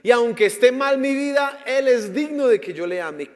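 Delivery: loud, high preaching at full force; fiery preaching. Real, not acted. Preacher challenging the congregation, loud, at full force. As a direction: thunder it out from the pulpit.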